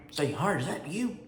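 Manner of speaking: put-on high, called-out greeting, as if spotting an old friend; surprised call from behind. Sergeant spots his old classmate, high surprised call. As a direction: sing out his name in surprise. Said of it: "I heard his voice behind me".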